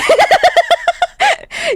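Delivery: helpless high-pitched giggle; high delighted laugh. Real, not acted. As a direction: burst into a giddy laugh.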